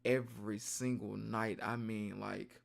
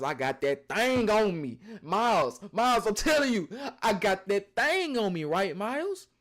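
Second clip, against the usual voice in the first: louder, pressing the point hard; hyped-up loud boast; 7 semitones higher, 8 dB louder than usual. Real, not acted. Telling Miles about the gun, a loud insistent boast. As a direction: lean in and insist he's armed.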